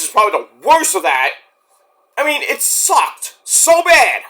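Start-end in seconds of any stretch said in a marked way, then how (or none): 2.2-4.2 disgusted. Real, not acted. Disgusted rant about movie.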